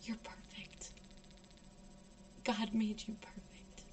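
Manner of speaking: hushed, whispered reassurance; soothing whisper. Retold or imitated. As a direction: whisper it gently, with long pauses. Shaman soothing the crying speaker.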